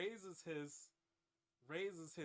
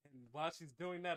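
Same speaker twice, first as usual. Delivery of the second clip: ordinary delivery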